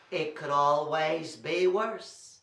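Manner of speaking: slow, drawn-out title; slow, deliberate title. Shown as a demonstration. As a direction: lay each word down slowly. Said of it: "Say that with me".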